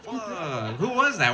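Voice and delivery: usual speaking voice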